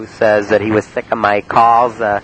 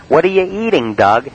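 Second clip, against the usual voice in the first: mock high voice; 6 semitones higher than usual. Quote repeated for Mrs Rawson.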